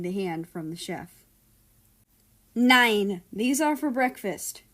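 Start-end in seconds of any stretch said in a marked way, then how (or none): 2.5-4.6 loud, higher sharp "Nein"; scolding. A narrator's reading. Chef scolding the son stealing biscuits, sharp and loud. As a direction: snap it at the thief, a touch raised.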